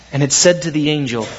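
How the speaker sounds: plain reading voice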